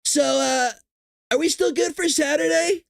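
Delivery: high, sheepish mock Trump; mock Trump call. Trump calling Iran after the strike, innocent high mock voice. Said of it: "picture Trump calling them up".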